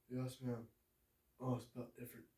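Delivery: low, hushed and humble; soft low John Coffey. Acted. John answers the sick woman, low and hushed. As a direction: mumble it softly.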